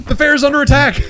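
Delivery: high, loud mock panic; mock alarm. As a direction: cry out that the fair's under attack. Mock alarm at game scene.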